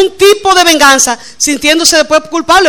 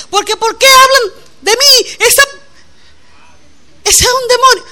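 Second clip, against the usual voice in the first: mock angry outburst; 9 semitones higher than usual. Wife retelling her own angry words to the pastor, high and indignant. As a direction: squeal it like a hurt, offended woman. Voicing herself angry, retold.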